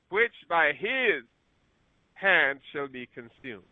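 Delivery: slow, softening finish; quiet solemn close of the reading; slow fading scripture close. Acted. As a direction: let the verse die away slowly. Reads verse sixteen aloud.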